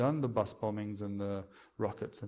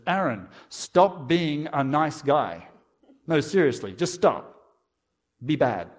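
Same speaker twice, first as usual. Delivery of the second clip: mock challenger; 3 semitones higher, 8 dB louder, slower than usual. Imagined challenger mocking him.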